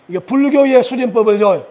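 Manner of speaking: ordinary talk, nothing marked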